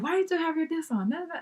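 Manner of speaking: half-voiced bossy complaint; mock yelling. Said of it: "They are yelling at me".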